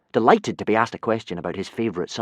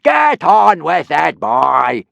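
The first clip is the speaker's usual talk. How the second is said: loud, slow, higher shout; impatient yell; 8 semitones higher, 12 dB louder, much slower than usual. Acted. Teacher yelling at a slow student. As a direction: yell it, fed up with waiting. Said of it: "yelled Gobber".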